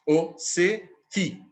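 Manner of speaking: "o … se … fi", three slow separate beats; syllable scansion. Shown as a demonstration. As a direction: count out each syllable of "o se fi". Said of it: "A segunda tem três". ("o se fi" escandido devagar, em três tempos)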